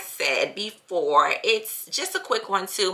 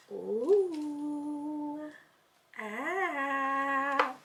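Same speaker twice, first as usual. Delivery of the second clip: impressed coo; 5 semitones higher, 7 dB quieter than usual. Real, not acted. Reacting to the new steamer.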